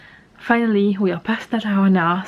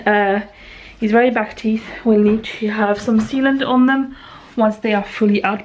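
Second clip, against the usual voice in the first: ordinary talk, nothing marked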